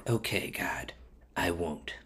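hushed, very soft submission; small, low, whispered reply; meek whisper. Acted. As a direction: give in meekly, almost whispering. Bilam meekly gives in to God.

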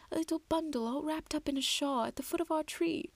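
lightly acted wistful motherly confession; tender troll mother. A narrator's reading. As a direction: recall it tenderly to a son.

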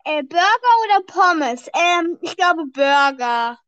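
loud, over music in her ears; speaking up, can't hear herself; too loud, headphones on. Real, not acted.

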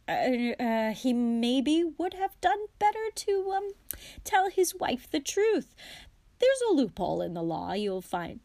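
hesitant husband stumbling over his defence; flustered excuse-making. A narrator's reading. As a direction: talk your way out of trouble, nervously. Guilty husband makes excuses to wife.

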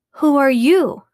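loud, high startled demand; yelling lion. Acted. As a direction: bellow it out at the boy. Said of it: "the lion yelled at my father".

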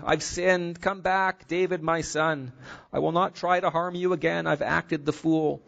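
a touch of guilty pleading; remorseful. A narrator's reading. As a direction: Saul begging David to return, sorrowful. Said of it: "responds with remorse. He's sorrowful".

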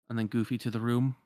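ordinary delivery, nothing marked

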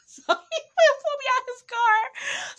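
very high squeal through laughter; helpless laughter. Real, not acted. Telling viewers about the car tussle, cracking up laughing. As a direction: squeeze the words out while cracking up.

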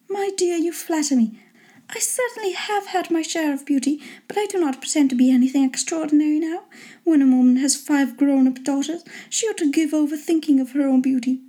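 high-pitched lady, modestly pleased; put-on high wife voice; flattered wife.

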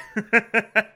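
short amused chuckle. Real, not acted. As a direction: chuckle at your own remark. Chuckles at own remark.

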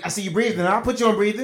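mock a know-it-all friend; teasing impression of a braggart; mocking imitation.

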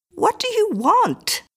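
high, slow, alarmed "What do you want?"; alarmed question. A narrator's reading. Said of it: "asked Missus Miller".

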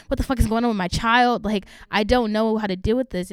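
loud, exasperated parent outburst; angry parents. Retold or imitated. Parents imitated yelling about their struggling child. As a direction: blow up like a parent at their wits' end. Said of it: "they're angry as shit".

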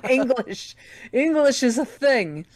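joking through laughter; laughing. Real, not acted. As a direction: laugh while you say it. Joking after stumbling over words.

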